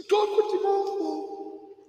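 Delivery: put-on high, slow scolding old lady voice; shrill nana telling you off, drawn out; a hammed-up grandma scolding the kid at the table; mock scolding grandma. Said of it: "from your parents".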